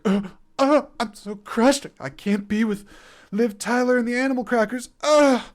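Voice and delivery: high, loud, hammed-up wail of heartbreak; mock-crushed Ben Affleck. Film reviewer mocking a tearful goodbye scene, hammed-up crushed whine. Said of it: "totally, completely undercutting the actual emotion".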